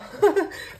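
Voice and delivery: a burst of happy laughter, high and loud; delighted high laugh. Real, not acted. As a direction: laugh out with pure joy. Delighted by her gift.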